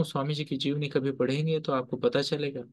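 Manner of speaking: normal talking voice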